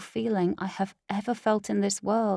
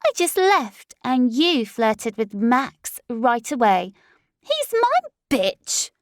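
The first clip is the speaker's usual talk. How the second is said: slow, much higher, loud jealous snarl; possessive outburst; 4 semitones higher, 6 dB louder, slower than usual. Acted. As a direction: stake your claim, seething. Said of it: "she exclaimed".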